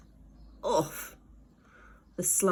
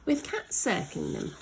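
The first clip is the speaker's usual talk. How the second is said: usual speaking voice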